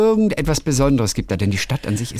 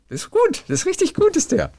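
pitched way up, delighted; squeaky high praise. Real, not acted. Praising own lyrics, very high. (quietschend hohe Begeisterung)

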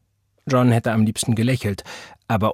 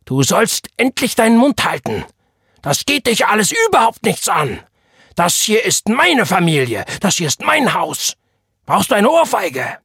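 high, loud furious yelling; frightening rage; 11 semitones higher, 7 dB louder than usual. A narrator's reading. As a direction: roar threats as the enraged father. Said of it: "schrie sein Vater mit beängstigender Wut". (in beängstigender Wut brüllen)

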